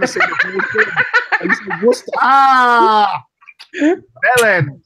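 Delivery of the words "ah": long, loud, high cry of revelation; exclaimed "ah". Real, not acted. American realising friends skip the syllables, loud cry. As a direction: shout a drawn-out, falling "ah". Sudden outcry on getting the trick.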